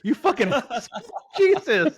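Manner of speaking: high, laughing outburst. Real, not acted. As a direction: crack up while cursing. Laughing at the lie.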